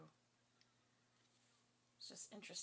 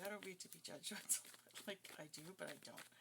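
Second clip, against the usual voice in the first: ordinary talk, nothing marked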